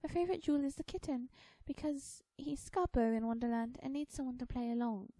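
put-on high, loud girlish voice; young girl Alice. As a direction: explain eagerly as a little girl.

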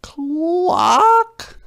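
very high, loud hen squawk; piercingly high chicken voice; hen cluck. A narrator's reading. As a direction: cluck like a startled storybook hen. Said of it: "said the hen".

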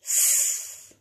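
S as a long voiceless hiss; phonics sound demo. Shown as a demonstration. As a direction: hold the sound of "S" as pure sss. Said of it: "Should we do those again".

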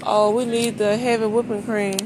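ordinary talk

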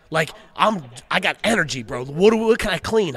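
loud, buzzing with energy; like he's wired and can't sit still; hyped-up energy. Retold or imitated. Telling his cohost about the coked-up cleaning rush, loud and buzzing. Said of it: "That's how I felt".

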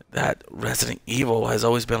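ordinary delivery

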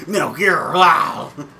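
loud growled game-hero voice; put-on growly action-hero impression; Snake. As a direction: growl the title out, ending in a roar. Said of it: "That's my Snake voice".

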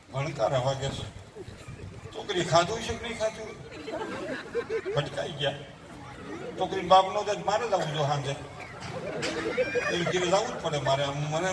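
low, hushed, anxious mother muttering to herself; worried mother demo. Acted. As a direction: play a fretful mother whose daughter won't pick up. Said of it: "જરાક demo બતાવું છે". (ચિંતાતુર માતાનો ધીમો, ગભરાયેલો અવાજ)

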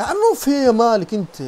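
loud, high, drawn-out scolding; exasperated shout. Real, not acted. Player scolding the troublesome horse, exasperated shout. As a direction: yell it in exasperation, stretching the words.

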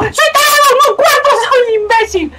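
very high, squealing outcry; frantic self-reproach. Real, not acted. Player cursing own mistake in game, frantic wail. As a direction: wail at your own blunder, high and stretched.

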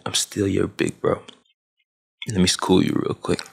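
ordinary talk, nothing marked

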